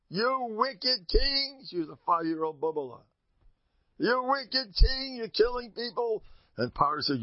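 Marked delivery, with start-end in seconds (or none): 0.1-1.6 put-on high, slow, drawn-out child's rebuke; little girl defying the king. Miriam as a small girl rebuking Pharaoh, high and slow. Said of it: "You know what Miriam told Paro?". 4.0-6.2 put-on high, slow, drawn-out child's rebuke; little girl defying the king. Miriam as a small girl rebuking Pharaoh, high and slow. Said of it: "You know what Miriam told Paro?".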